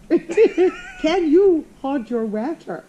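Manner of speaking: very slow, loud, high mock Glaswegian; mock attempted accent. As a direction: laughingly parody a bad Glaswegian accent. Mimics host's failed accent, laughing.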